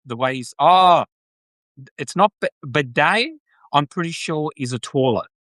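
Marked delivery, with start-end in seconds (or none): none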